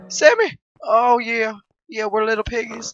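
ordinary talk

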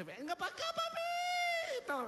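high squeal, last word hugely drawn out; eager woman calling. Acted. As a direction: call your man over, stretching the final word up high. Acts eager ugly woman calling man. (llamado agudo y alargado de mujer ansiosa)

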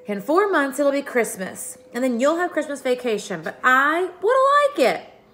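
high, rising plaintive wail; sad complaint. A narrator's reading. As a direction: whine about being left out. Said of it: "Pippi's voice sounded sad".

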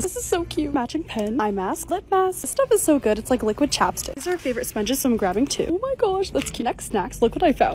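ordinary talk

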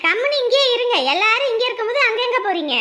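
loud, high hospitable scolding; insistent protest. Acted. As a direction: refuse to let them leave. Insists the in-law must stay.

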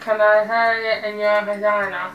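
talking around a mouthguard; words muffled by a gum shield; wearing a mouthguard. Real, not acted. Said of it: "the mouth guard challenge".

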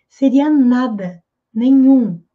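normal talking voice

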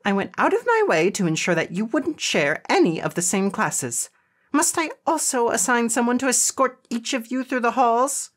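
high-pitched, officious scolding; snapping principal. A narrator's reading. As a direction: lecture them in a high, indignant voice. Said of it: "she snapped".

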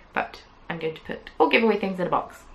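normal talking voice

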